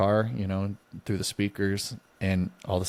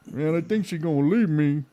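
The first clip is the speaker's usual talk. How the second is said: put-on much higher whiny drunk voice; drunk lament; 8 semitones higher, 3 dB louder than usual. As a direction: whine it like a sad drunk.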